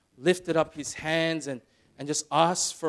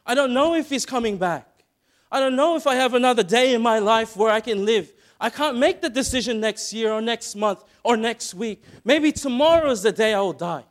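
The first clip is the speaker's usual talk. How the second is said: higher and much louder, pleading at full voice; desperate yelling; 8 semitones higher, 9 dB louder than usual. Retold or imitated. Blind Bartimaeus crying out to Jesus, desperate yell. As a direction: yell it as if it's your last chance. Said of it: "He was yelling".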